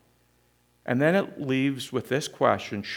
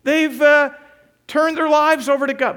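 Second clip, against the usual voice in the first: excited exclamation; 12 semitones higher, 7 dB louder than usual. Acted. Preacher exclaims Nineveh's repentance.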